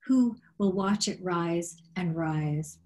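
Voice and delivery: normal talking voice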